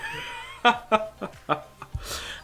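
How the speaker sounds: high, mocking villain laugh; All For One laughing at the boy, gloating villain laugh. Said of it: "All For One just starts to laugh".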